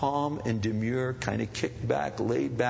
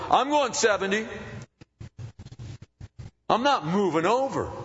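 stubborn driver; 11 semitones higher than usual. Retold or imitated. Pastor imitating a stubborn driver, mock indignant. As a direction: play the self-righteous driver. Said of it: "you're going".

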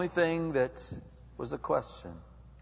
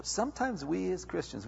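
ordinary talk

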